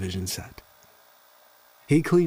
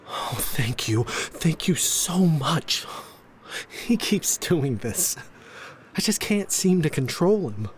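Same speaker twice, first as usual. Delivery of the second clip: higher, flustered mother's thanks; lightly voiced harried mom; grateful frantic mother; 6 semitones higher than usual. A narrator's reading. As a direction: gush relief, then exasperation.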